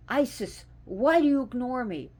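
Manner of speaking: loud, high hurt yell; yelling at a deity. Retold or imitated. Devotee yelling at Isis for ignoring her, loud. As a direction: shout it up at the sky, feeling ignored. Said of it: "you ever yell at your deities".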